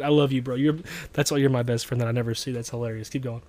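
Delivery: ordinary talk, nothing marked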